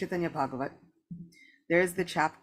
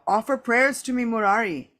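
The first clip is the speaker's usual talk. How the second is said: loud, higher-pitched divine command; commanding order; 6 semitones higher, 9 dB louder than usual. A narrator's reading. The Lord orders Murari to offer prayers, commanding. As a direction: issue it forcefully to a devotee. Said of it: "The Lord ordered Murari Gupta".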